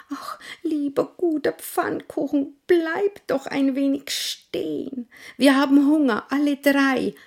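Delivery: hohe, bittende Kinderstimme; flehendes Kind. Acted. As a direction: flehend rufen wie ein Kind. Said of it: "rief das Älteste". (bettelndes Kind)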